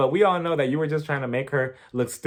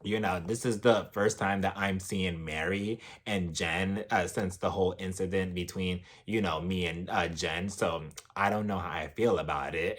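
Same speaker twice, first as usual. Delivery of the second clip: low, very soft monotone impression; Meredith; 9 semitones lower, 9 dB quieter than usual. Meredith uneasy about seeing Mary and Jen, low subdued imitation. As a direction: imitate someone subdued, dropping pitch and volume right down.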